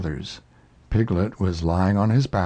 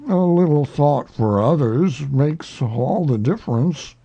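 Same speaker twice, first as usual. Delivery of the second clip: wag a finger at everyone; lecturing old donkey; 4 semitones higher, 4 dB louder than usual. A narrator's reading. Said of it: "he went on".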